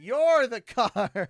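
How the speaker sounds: big drawn-out exclamation; loud teasing. Real, not acted. Husband teasing wife about the game, loud and stretched. Repeats the tease much louder.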